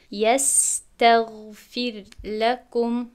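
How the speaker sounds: drawn-out recitation with the r kept light; slow tajweed recitation; slow Quran recitation. Shown as a demonstration. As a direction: recite slowly and carefully. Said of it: "recitation example".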